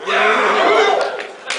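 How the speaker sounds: normal talking voice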